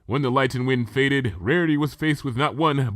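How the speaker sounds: plain reading voice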